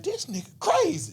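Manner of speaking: high, put-on voice of a guy at the pool; mocking onlooker. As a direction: lightly voice a heckling stranger. Dudes mocking him.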